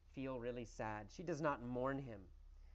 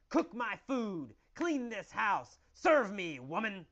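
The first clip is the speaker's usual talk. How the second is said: loud, higher, barked commands; bossy husband's yell; 5 semitones higher, 6 dB louder than usual. Acted. Authoritarian husband orders his wife about, commanding yell. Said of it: "Every day he yells at Kathy".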